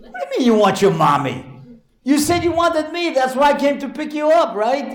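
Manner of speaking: high-pitched, incredulous protest; exasperated father. Retold or imitated. Father who drove over for his son, high exasperated protest. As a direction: act out a parent arguing in squeaky disbelief. Father arguing with crying boy.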